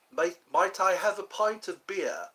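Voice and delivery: put-on English accent. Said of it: "He knows how to speak an English accent".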